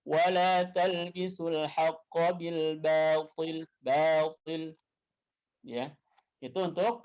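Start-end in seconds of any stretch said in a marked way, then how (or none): 0.0-4.7 slow recited verse; teacher modelling correct reading; correct recitation. Shown as a demonstration. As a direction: recite slowly with thin a. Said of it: "Harusnya dibaca batil".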